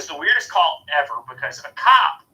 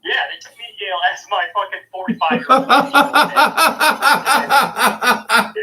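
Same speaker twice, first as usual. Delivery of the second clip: slow, higher and louder through laughter; laughing disbelief; 9 semitones higher, 8 dB louder, much slower than usual. Real, not acted. Telling a friend about the jail mix-up, laughing.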